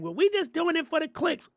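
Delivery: high mock voice of a clueless kid; mocking whiny kid. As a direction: parody a defensive young creator. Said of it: "and they be like".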